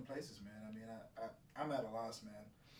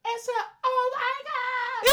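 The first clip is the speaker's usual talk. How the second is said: high shriek; 12 dB louder, slower than usual. Pretend. Escalating catchphrase.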